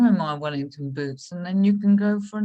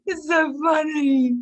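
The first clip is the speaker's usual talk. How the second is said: laughing; 9 semitones higher, 4 dB louder than usual. Real, not acted. Laughing at innuendo banter.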